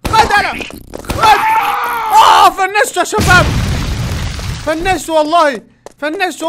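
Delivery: high, loud victory yell; triumphant shouting. Real, not acted. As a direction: crow over the win. Defeating the cook.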